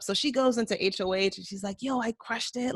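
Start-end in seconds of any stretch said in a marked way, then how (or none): 1.8-2.7 higher-pitched imitated boast; lightly acted glee; retold happy brag. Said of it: "she's all happy".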